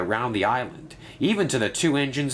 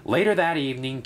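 ordinary delivery, nothing marked

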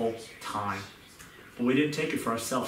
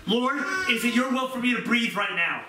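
earnest prayer; 8 semitones higher than usual. Retold or imitated. Voicing a sincere prayer.

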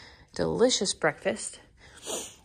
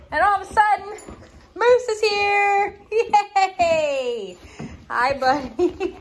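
high, bright burst of delight at the dog; excited greeting; 10 semitones higher, 4 dB louder, much slower than usual. Real, not acted. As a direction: squeal hello to the dog with a laugh.

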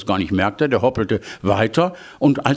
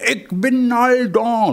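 high proud call; 11 semitones higher than usual. Acted. Demo of the wife's shout.

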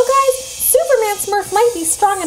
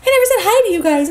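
ordinary talk, nothing marked

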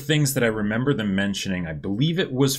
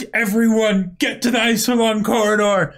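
high, loud panicked order; hammed-up panic, pitched way up; mock freak-out; 10 semitones higher, 5 dB louder than usual. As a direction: shout it like an alarmed commander. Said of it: "the Alliance is freaking out".

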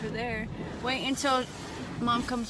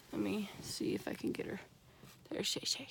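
whisper; 10 dB quieter than usual. Real, not acted. Sneaking up on the dog.

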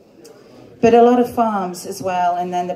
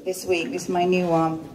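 ordinary delivery

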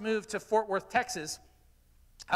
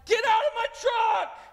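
much higher, louder shout ordering them out; angry shout; 12 semitones higher, 7 dB louder than usual. Retold or imitated. Friend shouting at men piled into his truck bed, angry shout. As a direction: bark it like the owner of the truck. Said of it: "and he's like".

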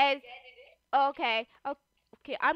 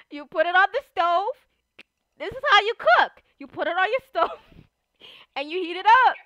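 loud, high demonstration voice; playful, emphatic cooking-show delivery; play cooking teacher; 4 semitones higher, 11 dB louder than usual. Pretend. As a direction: instruct a pretend class loudly, pitch lifted.

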